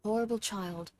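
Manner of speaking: put-on very soft, upset British child; small British-accented child's voice, kept gentle. Showing a friend her favourite voice, soft British child. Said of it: "I like to do this one".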